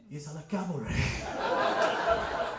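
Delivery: a slow, deep, bewildered uncle curse with a laugh; baffled Indian uncle. Retold or imitated. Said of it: "what all Indian uncles say".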